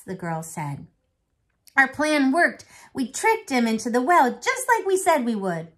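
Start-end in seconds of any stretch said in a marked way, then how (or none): 1.8-5.7 loud, high proud boast; excited boast. Acted. Girl boasting to grandfather, loud and proud. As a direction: crow over the plan.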